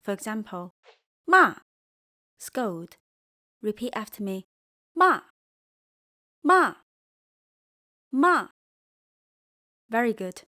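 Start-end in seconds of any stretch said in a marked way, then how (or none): 1.3-1.6 "骂" starts high and loud, drops quickly; sharp falling tone. Shown as a demonstration. Teacher demonstrating fourth tone, sharp and falling. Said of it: "Your voice drops quickly". 4.9-8.5 each "骂" high, loud, then quickly dropping; sharp falling tone. Shown as a demonstration. Teacher drilling for learners to repeat, sharp fall. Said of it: "sharp and falling".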